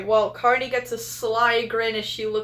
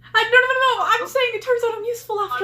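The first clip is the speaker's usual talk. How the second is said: high, fast, loud protest; excited protest; 11 semitones higher, 4 dB louder, much faster than usual. Acted. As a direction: blurt it out defensively. Protests stabbing suspicion, high fast.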